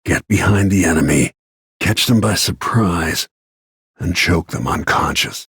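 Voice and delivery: ordinary talk, nothing marked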